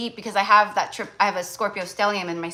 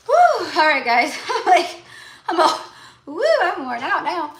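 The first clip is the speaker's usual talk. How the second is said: high, loud Southern whoop; put-on Southern accent; 9 semitones higher, 6 dB louder than usual. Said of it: "my southern accent too".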